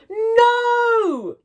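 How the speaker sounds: high, loud shouted no. Acted. Joe shouts no at the disbelieving kids. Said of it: "shouted Joe".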